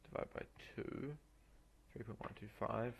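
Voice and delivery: normal talking voice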